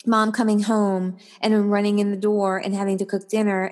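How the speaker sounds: ordinary talk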